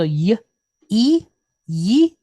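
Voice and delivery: y then e, blended into "yi"; sounding out yi. Shown as a demonstration. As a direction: spell "yi" out sound by sound. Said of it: "pointed kirik".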